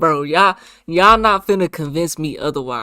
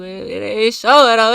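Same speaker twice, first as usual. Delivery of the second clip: louder and higher, exasperated; complaining loudly at the screen; frustrated outburst; 7 semitones higher, 7 dB louder than usual. Real, not acted. Man complaining the payment won't show, frustrated and loud. Money not showing, getting frustrated.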